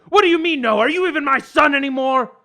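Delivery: a father disowning his son; indignant dad. Acted.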